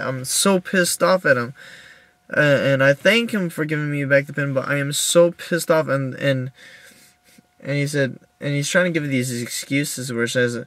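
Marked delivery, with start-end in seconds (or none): none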